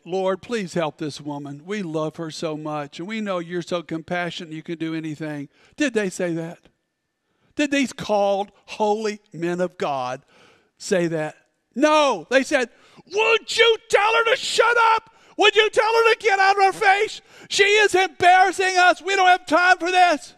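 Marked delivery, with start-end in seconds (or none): 0.0-5.5 softer, syrupy, rushing the last line; overly pious and gentle, tongue in cheek; mock sweet plea. Disciples asking Jesus to help the woman, mock saintly and soft. 13.0-20.3 much higher and louder exasperated yell; annoyed shouting. Acted. Disciples yelling at Jesus to send the woman away, exasperated.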